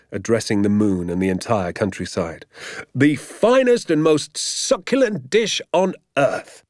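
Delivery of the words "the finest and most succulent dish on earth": high, slow, relishing proclamation; rapturous cry. A narrator's reading. Father praising the dish to the moon, rapturous cry. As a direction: savour each word as you proclaim it. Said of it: "he cried out".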